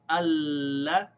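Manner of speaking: "Allah" opening on a short a, doubled l held long; slow, connected "Allah" reading demo; first syllable with fatha. Shown as a demonstration. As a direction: draw out the l in "Allah", light a. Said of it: "we pronounce it with the fatha".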